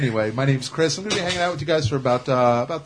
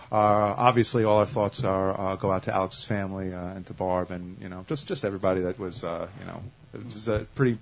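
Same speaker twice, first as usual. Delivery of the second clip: somber; 6 semitones lower, 6 dB quieter than usual. Real, not acted. Announcing a friend's death.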